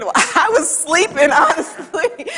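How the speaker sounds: slow, amused confession through a laugh; laughing aside. Retold or imitated. Wife's honest answer to the doctor, laughing slowly. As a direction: chuckle it out slowly. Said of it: "And I'm thinking".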